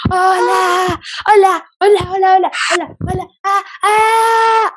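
high, excited greeting shouted under the breath; gritar en voz baja. Real, not acted. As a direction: shout the hellos but keep them hushed. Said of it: "estoy como que gritando en voz baja". (gritando en voz baja)

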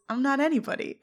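understated sad retelling; sadder. Retold or imitated.

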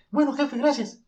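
very high, timid squeak; squeaky high voice. Retold or imitated. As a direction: thank the boss in a tiny high voice. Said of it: "Entonces dice". (voz aguda y tímida)

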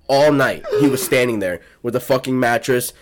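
ordinary talk

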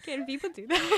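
laughing. Real, not acted. Joking about one woman suing.

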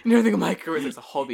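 hammed-up partner nagging; mock nagging partner.

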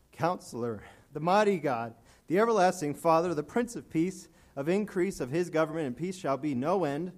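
scripture proclaimed from the pulpit with swelling fervour; preaching scripture. Acted. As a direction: build the prophecy toward its peak. Reads Isaiah at full preaching force.

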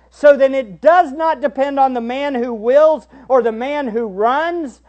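preached Bible reading; preaching. Real, not acted. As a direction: proclaim the verses.

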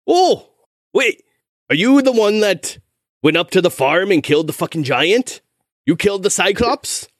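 high, incredulous blacksmith; astonished recognition. Acted. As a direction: realization bursting into eager questions. Smith suddenly recognizes the hero.

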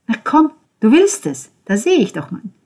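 high, loud, pushing taunt; goading. Acted. Ben goading Konrad to touch Georgia, high and loud. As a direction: egg him on hard.

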